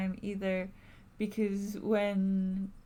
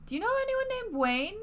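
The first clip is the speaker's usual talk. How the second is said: much higher, squeaky question. Acted. Player character asking Tabitha about Wayne in a squeaky voice. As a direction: ask it in a squeaky put-on voice.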